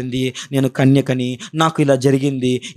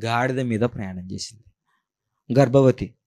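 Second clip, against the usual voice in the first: usual speaking voice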